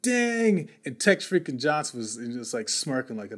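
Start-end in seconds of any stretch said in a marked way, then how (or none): 0.0-0.7 startled dang. Retold or imitated. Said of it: "I was like".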